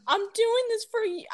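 put-on high, put-upon child line; mock kid voice. Kid pleading with his mom, high. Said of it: "I hate when he calls her woman".

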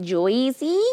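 "Josie" as "Joyzee", rising in question; puzzled mispronounced "Josie". Retold or imitated. Confused American repeating the name, questioning "Joyzee". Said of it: "they're like".

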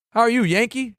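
mock Southern welcome in a Texas accent. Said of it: "in his Texas voice".

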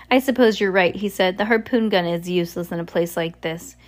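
plain reading voice

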